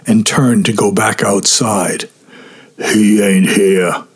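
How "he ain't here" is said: muffled, slow, face in a pillow. A narrator's reading. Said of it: "said Meat's muffled voice".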